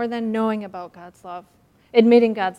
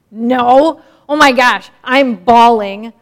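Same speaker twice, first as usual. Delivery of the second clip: overwhelmed outburst; 13 dB louder, slower than usual. Pretend. Son returns, mother overwhelmed.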